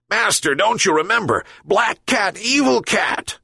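the dog scolds its master, hammed-up; angry yelping dog. A narrator's reading. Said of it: "yelped the dog even more angrily".

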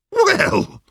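squeaky high opening word; high "well". Acted. Horse begins complaint.

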